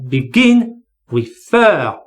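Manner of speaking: be-GIN, re-FER; stress on final syllable. Shown as a demonstration. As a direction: begin and refer, second syllables stressed. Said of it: "stress is on the final syllable".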